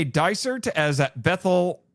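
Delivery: ordinary talk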